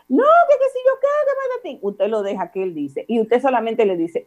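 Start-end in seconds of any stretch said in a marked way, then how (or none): 0.1-1.8 put-on high, whiny kid protest; mock talking-back child. A child grumbling back at the parent, high mock whine. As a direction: mimic a sulky child mouthing off.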